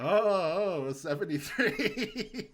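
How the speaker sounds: loud, high, slow gloat; quoting the monster at game night. Retold or imitated. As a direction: draw out seventy-three to taunt a friend. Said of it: "I'll go".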